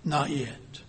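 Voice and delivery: calm the frightened clay; gentle. Acted. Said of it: "gently and simply said".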